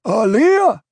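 high, loud shout of a name; forceful man's call. A narrator's reading. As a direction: hail her over the crowd. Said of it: "a man directly in front of Paul called".

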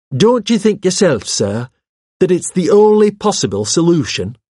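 high, insistent appeal; urgent persuasion. Acted. Hawes presses his theory on the vicar, urgently.

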